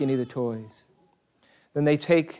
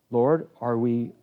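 usual speaking voice

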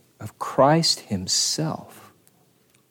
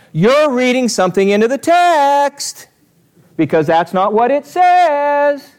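a mock objector building from firm to loud, much higher protest; indignant objector; 11 semitones higher, 5 dB louder than usual. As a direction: play the offended skeptic, rising to a high shout. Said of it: "somebody might look at that and go".

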